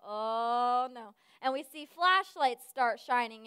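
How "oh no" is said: long drawn-out "oh"; a sinking, drawn-out groan of a thought; mock dread. Camper caught awake late at night, mock dread.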